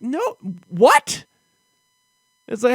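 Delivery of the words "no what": high, loud rising no what; can't believe what she said; shocked disbelief. Retold or imitated. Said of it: "I'm like".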